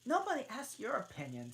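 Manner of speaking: high, quick retort; huffy Alice. Acted. Alice rebuking the Hatter, high and quick. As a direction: snap back.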